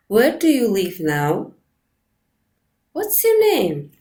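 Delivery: "Where do you live now?" and "What's your name?" ending on a gentle low rise; friendly low rise. Shown as a demonstration. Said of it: "special question with a low rising tone".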